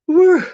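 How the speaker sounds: big loud exhale of breath; loud whew. Real, not acted. Exhaling before a tough question.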